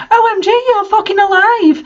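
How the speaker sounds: high, thrilled squeal of disbelief; a friend's high-pitched gasp of delight; excited squeal. A narrator's reading. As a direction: shriek it out in joyful shock. Jenny bursts in excited.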